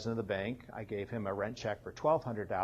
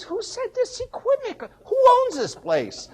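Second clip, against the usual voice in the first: much higher, louder, slow yelling inspector; furious shout; 10 dB louder, slower than usual. Retold or imitated. Building inspector yelling at the workers about who owns the place, slow furious shout. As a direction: demand it slowly at full volume, furious.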